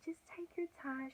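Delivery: high, whispery soothing voice; sweet nurse. Retold or imitated. Triage lady soothing her in labor, sweet high whisper. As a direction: imitate a gentle, very soft coaxing. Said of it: "She's so sweet".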